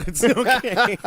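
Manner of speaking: loud slow laughing reassurance. Real, not acted. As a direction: laugh it out, slowly. Laughing after joke.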